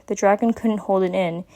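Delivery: ordinary delivery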